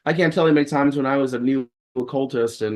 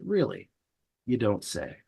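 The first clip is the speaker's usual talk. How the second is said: dry sarcasm; 2 semitones lower, 6 dB quieter than usual. Retold or imitated. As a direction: drop it flat and knowing. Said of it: "feeling a little salty".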